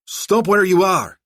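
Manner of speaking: loud, high, slow challenge; shouted. A narrator's reading. Bodyguard to menacing figure at the door, shouting. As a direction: shout a halt, drawing out the words. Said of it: "Najjar shouted in Farsi".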